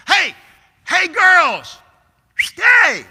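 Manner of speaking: put-on very high, loud call to the girls upstairs; dad bellowing up to his daughters; calling up the stairs. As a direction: holler up the stairwell over the music.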